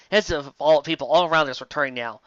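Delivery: ordinary talk, nothing marked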